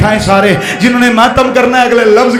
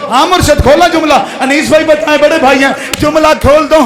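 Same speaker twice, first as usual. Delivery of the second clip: ordinary talk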